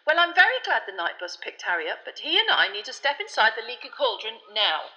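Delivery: politely firm, wanting to move on; Fudge, testy. A narrator's reading. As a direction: Fudge hustling Harry inside. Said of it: "said Fudge testily".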